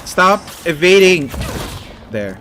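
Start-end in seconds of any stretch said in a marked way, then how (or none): 0.0-1.3 urgent pleading under attack; panicking gamer. Real, not acted. Panicked fight with enemies.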